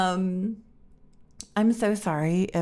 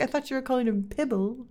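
quick, higher-pitched sister impression; imitating sister; 6 semitones higher, faster than usual. Sister explaining her mistake about the cat, quick higher imitation. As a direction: mimic your sister, fast and pitched up. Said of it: "She's like".

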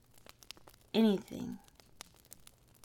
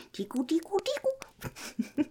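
laughing; 12 semitones higher than usual. Acted. Tickling partner on the couch.

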